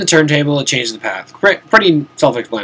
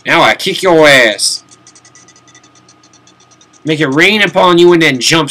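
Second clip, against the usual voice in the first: louder, slow, drawn-out taunt; mock taunt; 5 semitones higher, 5 dB louder than usual. Taunting the sleeping monster, slow gloating threat. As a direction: gloat over a sleeping foe.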